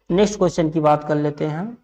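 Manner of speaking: ordinary talk, nothing marked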